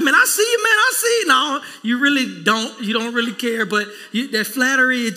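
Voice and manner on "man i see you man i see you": dude's praise. Retold or imitated. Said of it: "Dudes be like".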